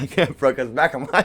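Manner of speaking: ordinary talk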